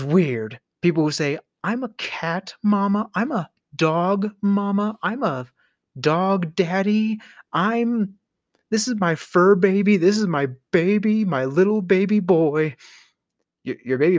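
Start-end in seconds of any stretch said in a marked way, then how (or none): 1.6-12.8 high, cooing pet-owner voice; mock doting pet parent. Pet owner shows off their baby, mock doting. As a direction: coo over your fur baby, hammed-up.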